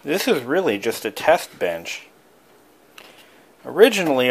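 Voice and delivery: normal talking voice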